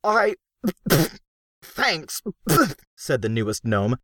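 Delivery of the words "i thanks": higher, sputtering between coughs; coughing thanks. A narrator's reading. Crockett coughs after Gru rubs dust in his face. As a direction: choke on dust while thanking.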